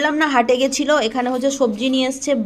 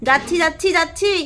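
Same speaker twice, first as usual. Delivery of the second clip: higher, rising call of reply; raised voice answering someone across the house; calling back; 5 semitones higher, 4 dB louder than usual. Real, not acted. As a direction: call out that you're on your way. Calls back to someone summoning her.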